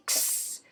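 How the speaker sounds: "ks" breathed with no voice, k and hiss; whispered ks. Shown as a demonstration. Teacher showing the S in X as "ks". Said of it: "e do S".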